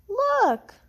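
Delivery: high, eager exclamation; squealed look; excited call. A narrator's reading. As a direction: point it out with excitement.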